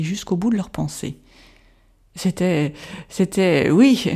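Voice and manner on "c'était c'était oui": shaken stammer, rising to a louder oui. A narrator's reading. Shocked soldier stammering to his comrades. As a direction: trip over the words in shock. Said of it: "bredouilla l'un d'eux".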